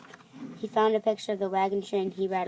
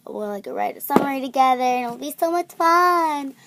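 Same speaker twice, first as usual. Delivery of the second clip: excited; 4 semitones higher, 6 dB louder than usual. Real, not acted. Building excitement about plan.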